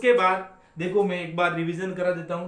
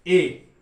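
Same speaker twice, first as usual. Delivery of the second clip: ए said loud as a full ay; strong ए, loud and clear; strong form a; 9 dB louder than usual. Shown as a demonstration. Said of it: "strong form में बोलना है".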